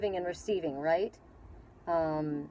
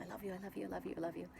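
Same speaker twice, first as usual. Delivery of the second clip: soft, hushed, barely voiced; hushed loving murmur; quiet repeated I love you; 14 dB quieter, much faster than usual. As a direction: murmur it softly as if to yourself. Repeating love during a hug.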